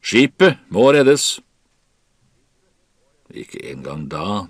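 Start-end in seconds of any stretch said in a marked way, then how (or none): 0.0-1.4 high, loud, drawn-out command; Kaptein Wilkinson shouting. A narrator's reading. Captain urging crew to save the ship, shouted. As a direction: shout the order to the whole crew. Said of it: "skrek kaptein Wilkinson".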